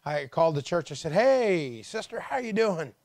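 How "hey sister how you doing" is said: high, loud call down the phone; acted-out cheery hello; hearty greeting. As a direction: greet her with a big upward swoop. Retells his cheerful phone greeting.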